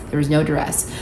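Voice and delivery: quiet repeat. Shown as a demonstration. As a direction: drop it quietly, as if for the lawyers. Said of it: "Gotta say that for legal reasons".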